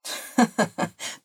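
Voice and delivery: short acted chuckle; laughing. A narrator's reading. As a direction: chuckle in character as the amused friend. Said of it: "lachte Juan". (kurzes gespieltes Lachen)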